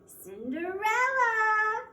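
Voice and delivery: high, loud, drawn-out call of her name; a spoiled younger stepsister calling loudly and slowly. A narrator's reading. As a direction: yell for her across the house. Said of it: "called the younger".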